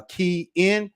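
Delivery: key in, drawn out slowly; slow spelled-out pronunciation. Shown as a demonstration. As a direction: stretch key in like a sound-alike hint. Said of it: "same pronunciation as what you see".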